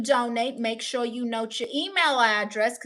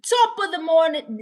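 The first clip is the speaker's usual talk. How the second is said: hammed-up Irish greeting, pitched high and loud; attempted Irish; 5 semitones higher, 6 dB louder than usual. Streamer failing at an Irish greeting, attempted Irish accent. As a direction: have a go at a bad Irish accent. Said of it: "See, I can't do it".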